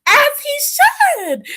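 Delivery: high, slow, drawn-out sass; high slow diva drawl; sassy agreement. Real, not acted. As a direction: say it like a diva approving.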